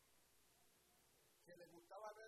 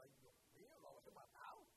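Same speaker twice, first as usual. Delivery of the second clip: soft, breathy hushed worry; worried whisper; 7 semitones higher, 3 dB quieter than usual. Retold or imitated. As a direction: whisper it as if you fear you killed him. Pastor fears he killed man.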